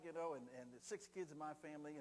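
ordinary delivery

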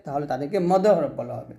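ordinary talk

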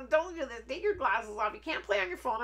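high, nagging mom imitation; mock-scold like a fussing mother; imitated scolding mom. Imitating mom scolding during hair braiding.

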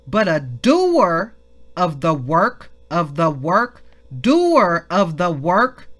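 "doer" drawn out slowly and loud; emphatic repetition. Real, not acted. Preacher emphasizing scripture to the congregation, "doer" long and punched. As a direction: hammer "doer" and "work", stretching them. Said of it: "Let's emphasize that".

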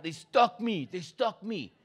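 high, soft wounded voice; stabbed neighbor. Retold or imitated. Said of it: "He's like".

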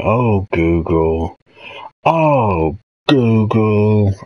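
slow, drawn-out mock-exclaimed meme line; meme impression. Streamer doing a favourite meme for chat requests, drawn-out meme impression. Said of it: "a meme impression".

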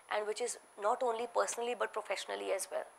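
usual speaking voice

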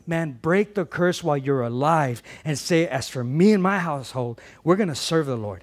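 loud, rising preacher's declaration; preaching climax. Real, not acted. Pastor leading the congregation's declaration over music, loud and rising. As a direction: build it to a triumphant close.

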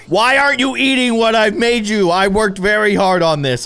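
high, loud indignant wail; playfully berate, loud and high; mock outrage. Cook to customer refusing his food, mock outrage.

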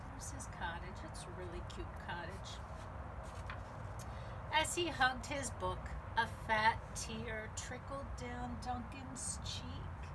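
ordinary delivery

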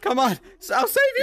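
very high, loud urging; high-pitched rescue cry; frantic. Real, not acted. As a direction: squeal it urgently to the one you're rescuing. Frantic rescue attempt in game.

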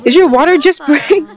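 high-pitched imitation of a panicking partner; lightly acted panic; mock panic. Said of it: "definitely he's panicking now".

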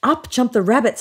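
usual speaking voice

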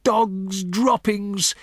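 high, drawn-out yell; mock yell. A narrator's reading. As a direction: stretch it out as an alarm cry. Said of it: "then the yell of".

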